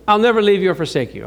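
brisk, light reading of the verse; quick simple reading. Shown as a demonstration. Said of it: "Very simple".

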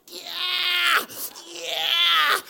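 loud, high mock shriek; mock child's scream. Child clinging, refusing to go.

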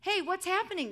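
loud, high cry of alarm; dramatised shout for a story; storybook yell. A narrator's reading. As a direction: yell out in startled confusion. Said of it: "yelled the disciples".

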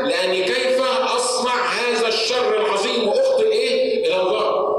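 high, impassioned preacher's cry; impassioned high preaching, crying out to the congregation; full-force preaching. Acted. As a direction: preach it out with urgent conviction.

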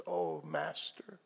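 very soft, slow and low; hushed, drawn-out recognition; awed recognition. Retold or imitated. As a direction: breathe it out slowly in hushed awe. Mary recognises Jesus, retold.

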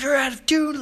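high-pitched mock scolding; in-your-face accusation. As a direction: imitate a bullying bandleader accusing a player. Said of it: "gets up right in this guy's face".

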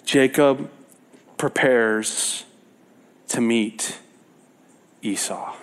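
low, slow, fading to a whisper on Esau; slow dramatic title read. Shown as a demonstration. Preacher reads a Bible section title slowly, dropping to a whisper. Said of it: "It says".

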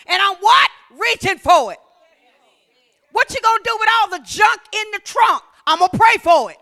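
rousing call-and-response preaching; full-force preaching. Acted. Preacher stirring the congregation, rousing and loud. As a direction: lift the crowd with every kingdom word.

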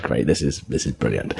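low, soft, dry sarcastic aside; knocked over again, a low, soft sarcastic grumble; deadpan sarcasm. Retold or imitated. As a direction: mutter it flatly from the floor.

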